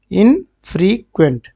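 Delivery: "इनफ्रीक्वेंट" slowly split into in-free-quent; syllable-by-syllable pronunciation. Shown as a demonstration. Teacher modelling a new word for students, "इनफ्रीक्वेंट" in slow syllables. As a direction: sound out "इनफ्रीक्वेंट" with gaps between syllables.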